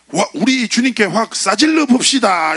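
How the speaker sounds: slow, high, loud Jeolla-dialect shout; Jeolla-dialect hype. Retold or imitated. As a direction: fire up the crowd like a Jeolla worship leader. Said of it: "이렇게 멘트 쳐요".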